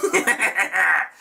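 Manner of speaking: high mock villain cackle; gleeful imitated Joker laugh. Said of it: "Mark Hamill as a guest voice actor".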